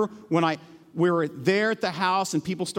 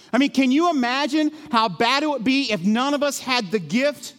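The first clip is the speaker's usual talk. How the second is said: high, loud impassioned preaching; preaching at full force; 11 semitones higher, 5 dB louder than usual. Acted. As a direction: press the congregation hard with each question.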